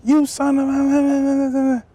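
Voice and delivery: high, slow, stretched-out yell; mock furious coach insult; retold coach yelling. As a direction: bark it like an angry line coach. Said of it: "he's giving it to him".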